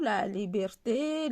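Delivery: ordinary delivery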